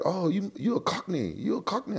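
high-pitched imitation of a girl; retold girl. Fighter retelling a girl calling him Cockney, high imitation. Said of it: "she started calling me, uh, Cockney".

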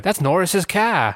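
slow, high New England drawl; New England accent. Retold or imitated. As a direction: imitate Stephen King's Maine accent. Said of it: "he does the accent super well".

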